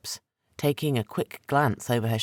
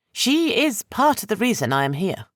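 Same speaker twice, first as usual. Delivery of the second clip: higher woman's voice, tense; 10 semitones higher, 4 dB louder than usual. Acted. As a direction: voice her nervously, pitched up.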